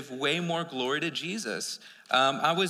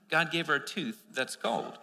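ordinary delivery

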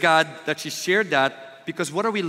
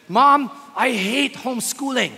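put-on higher, louder, slow whiny child voice; daughter's complaint; 6 semitones higher, 6 dB louder than usual. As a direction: play the sulky daughter, drawn out. Daughter quoted complaining to mom.

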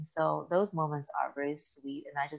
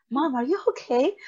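put-on a higher voice for the worried boys; worried kids; 9 semitones higher, 5 dB louder than usual. Sons at the bathroom door ask mom, lightly voiced worried child. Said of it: "knock on the door".

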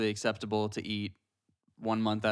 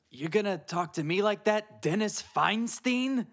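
Jason Mantzoukas impression; 10 semitones higher than usual. Shown as a demonstration. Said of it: "What's your best Jason Mantzoukas impression?".

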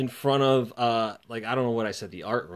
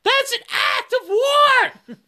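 mock outrage; 7 dB louder, slower than usual. Mock outrage at being tripped.

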